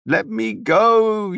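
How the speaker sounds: very high, slow desperate cry. A narrator's reading. The daughter struggles against Devon, crying out very high and slow. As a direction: plead to be let go, wailing. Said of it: "she cried".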